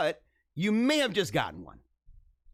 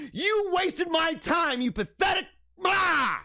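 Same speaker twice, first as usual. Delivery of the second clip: slow furious rant; 9 semitones higher, slower than usual. Acted. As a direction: stretch each word out in outrage, then spit the blah. Ranting at TikToker's fake apology.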